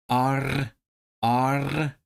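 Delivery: R rolled out at the end; trilled R. Shown as a demonstration. Learner drilling the pirate sound, trilled R. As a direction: roll the tongue on R. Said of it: "they do use the trilled R".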